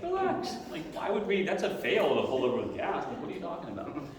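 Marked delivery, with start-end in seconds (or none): 0.0-0.6 high mock relax. Telling panicking friend to relax.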